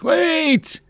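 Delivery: shrill, very high cry of wait; high pleading shout; desperate cry. Acted. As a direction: call out desperately from the carriage. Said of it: "Her voice cried out".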